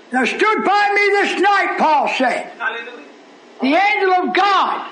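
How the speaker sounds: high, slow proclamation of scripture; preached scripture. A narrator's reading. Preacher reading the angel's visit to Paul, ringing proclamation. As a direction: declaim each phrase high and drawn out.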